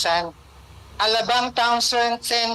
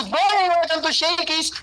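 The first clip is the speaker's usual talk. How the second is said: outdo the captain with a bigger greeting; over-the-top greeting; 7 semitones higher than usual. Retold or imitated. New waiter tries to out-shout the captain, hammed-up and high. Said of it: "kailangan galingan ko".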